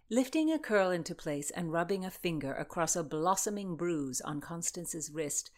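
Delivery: ordinary delivery, nothing marked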